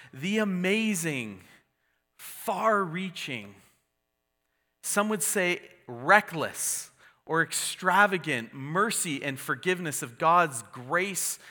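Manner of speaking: drawn out, each word savoured; slow, deliberate awe. Real, not acted. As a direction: linger on every word of grace. Savouring the extravagance of mercy.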